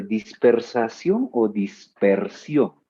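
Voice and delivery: Dispersación and dispersión each spoken slowly; slow choice question. Shown as a demonstration. Teacher offering two forms to pick from, slow and clear. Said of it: "¿cómo se dice?".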